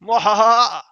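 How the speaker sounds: loud, high villainous mwahaha; evil cackle. Pretend. Villain gloat after combo.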